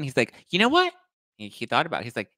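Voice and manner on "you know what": very high, sassy imitation; hammed-up high-pitched huff; mock indignant customer. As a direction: act out a customer getting worked up.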